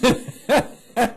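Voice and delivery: high hammed-up shriek turning to a laugh; mock scream. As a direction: imitate her startled shriek, half laughing. And she screams.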